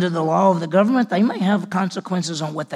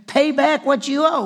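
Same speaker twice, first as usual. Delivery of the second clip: high, slow, harsh demand; 7 semitones higher than usual. Acted. Slave choking his fellow slave, furious demand. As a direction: grab him and demand the money.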